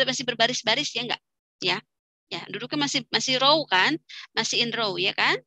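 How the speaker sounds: usual speaking voice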